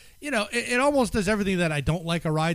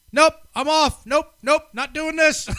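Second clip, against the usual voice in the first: loud, high panicked backing out; panicked refusal; 10 semitones higher, 7 dB louder than usual. Retold or imitated. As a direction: bail out loudly in panic. Retelling panic on the ride.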